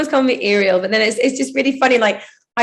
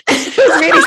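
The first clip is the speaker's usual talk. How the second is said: laughing; 8 semitones higher, 5 dB louder than usual. Real, not acted. Laughing at the story.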